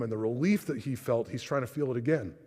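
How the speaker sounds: usual speaking voice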